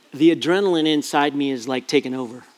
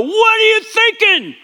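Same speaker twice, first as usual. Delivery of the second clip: much higher, louder furious outburst; exploding at daughter; 5 dB louder than usual. Retold or imitated. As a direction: let it burst out at her. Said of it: "I exploded".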